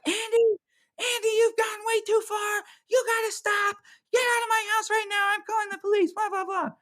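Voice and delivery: a mother ordering her husband out; mom shouting. Retold or imitated.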